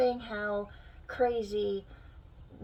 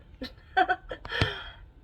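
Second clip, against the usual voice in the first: laugh; 11 semitones higher, 5 dB louder than usual. Real, not acted.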